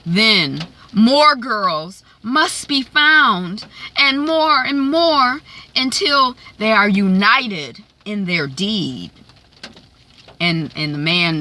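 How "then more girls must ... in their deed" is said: slow, louder, stretched mock-sinister speech; ominous declamation. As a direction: play a scheming madam proclaiming a dark plan. Acting out Quigley's sinister speech.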